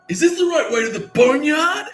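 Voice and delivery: loudly asking the way to the Boneyard on purpose; super loud. Retold or imitated. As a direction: belt the question out for the whole jail to hear. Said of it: "fucking super loud".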